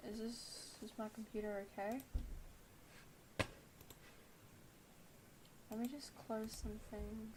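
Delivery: muttered to herself, soft; quiet. Real, not acted. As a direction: talk quietly to yourself. Distracted with laggy computer.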